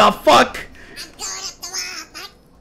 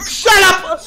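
exasperated shouting; 4 semitones higher, much slower than usual. Real, not acted. Player telling screaming teammates to shut up, exasperated shouting. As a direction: shout over the screaming to stop it.

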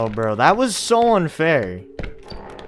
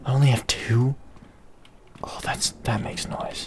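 soft whisper; hushed; 6 semitones lower, 7 dB quieter than usual. Real, not acted. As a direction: whisper to stay hidden. Hiding from listening monster.

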